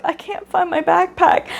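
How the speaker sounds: a small voice asking the teacher for help; lightly voiced worried kid; upset child. Child tells teacher about backpack.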